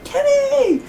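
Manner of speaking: very high wailing "Kenny!" like in South Park; South Park Kenny cry. Retold or imitated. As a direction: wail the name in mock grief. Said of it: "He's like".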